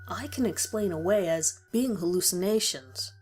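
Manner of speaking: ordinary delivery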